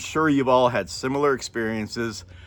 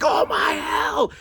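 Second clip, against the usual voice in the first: high, drawn-out mock scream; re-enacted scream, pitched way up; much slower than usual. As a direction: yell it out as a fake scare. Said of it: "Yelling and screaming and pointing".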